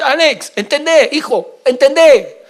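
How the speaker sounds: loud pleading urging; pastor's urging. Retold or imitated. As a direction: press it home like a pastor. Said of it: "hacerme entender y decir".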